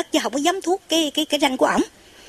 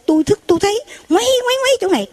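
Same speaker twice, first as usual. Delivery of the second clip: excited high telling; 10 semitones higher, faster than usual. Retold or imitated. Retells the spinning light.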